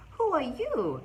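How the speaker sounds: loud, high astonished question; gasped question. A narrator's reading. The friends meet the creature on the doorstep, gasped question. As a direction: gasp it, voice jumping up. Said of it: "everyone gasped".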